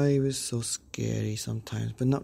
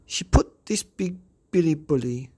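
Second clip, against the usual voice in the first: ordinary delivery, nothing marked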